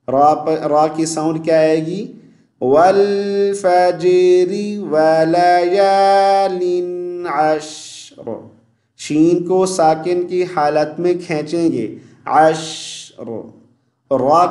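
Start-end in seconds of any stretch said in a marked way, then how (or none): 2.6-8.5 slow, drawn-out chanted recitation; model recitation. Shown as a demonstration. Teacher recites slowly for viewers to repeat. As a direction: recite it smoothly so students can follow. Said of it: "अब सुनिए मैं कैसे पढ़ता हूँ".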